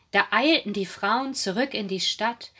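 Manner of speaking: ordinary delivery, nothing marked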